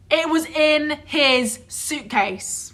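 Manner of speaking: very slow, high-pitched disbelief; incredulous emphasis. Real, not acted. Friend stressing where the passport was, slow and incredulous. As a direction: draw out each word in outrage. Outraged repeat of the punchline.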